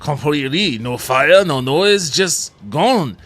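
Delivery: loud, drifting French-ish mechanic voice; vague French accent. Acted. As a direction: play the village mechanic, wobbly French accent. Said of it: "I don't even know what accent I'm doing anymore".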